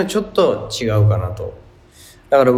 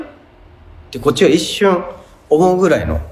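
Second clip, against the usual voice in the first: usual speaking voice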